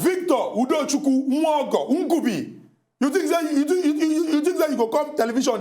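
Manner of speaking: high, loud name-calling, then stammered outrage; outraged retaliation. Acted. Host retaliates with co-host's full name, outraged shouting.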